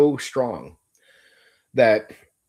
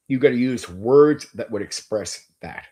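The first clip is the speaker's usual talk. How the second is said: normal talking voice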